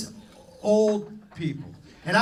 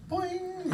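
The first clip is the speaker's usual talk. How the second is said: comic boing; 10 semitones higher, 4 dB quieter than usual. Retold or imitated. Comic reaction to the bouncer.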